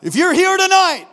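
high, booming mock altar call; mock preacher shout. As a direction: suddenly belt it out like a revival preacher, joking. Mock altar call, then just kidding.

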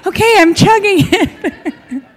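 high, slow, laughing give-in; high laughing mock surrender; laughing hurried concession. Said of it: "I'm like".

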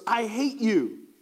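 a mild retold scream of hatred; imitating a screaming man. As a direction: drag out the hate, mock menace. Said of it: "he starts screaming these awful things".

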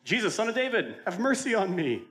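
loud, high cry for mercy; high-pitched acted shout. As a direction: shout it out desperately to be heard over a crowd. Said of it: "all he did was shout".

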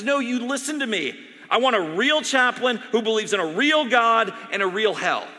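loud, high commanding voice; angry demand. Retold or imitated. Dying doctor demanding a real chaplain, angry. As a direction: insist hard.

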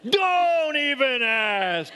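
high, slow, exasperated cashier voice; grumpy cashier. Retold or imitated. Cashier answering the pastor's question in a high, mock-weary voice. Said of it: "if I had said to her".

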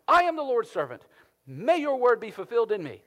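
high, loud triumphant proclamation; hammed-up victory cry; mock triumphant. Preacher mocks a triumphant Mary, loud and high. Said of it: "she is not saying that in a moment of triumph".

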